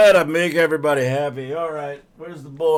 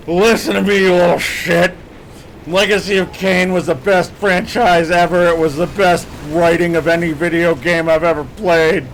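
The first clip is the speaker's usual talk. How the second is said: loud, slurry barroom tirade at a game; drunk rant; 4 dB louder than usual. Pretend. Streamer rants loudly for a viewer's request, drunk. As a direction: bellow it like a drunk at closing time. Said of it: "Kane wants a drunk rant".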